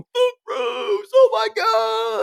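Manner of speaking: very high crying shout, drawn out; overjoyed fan crying out at a late night fight; tearful fan shout. Retold or imitated. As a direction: scream her name through tears of joy. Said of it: "two o'clock in the morning in my living room going".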